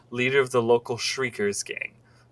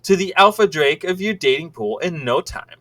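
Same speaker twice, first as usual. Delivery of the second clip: lively, swooping promotional read; sales-pitch reading; 6 semitones higher, 6 dB louder than usual. Acted. Reader presenting a romance guide to the lovelorn, sales-pitch style. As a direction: pitch it like an eager advertiser.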